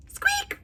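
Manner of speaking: tiny high squeak; high mouse squeak. Acted.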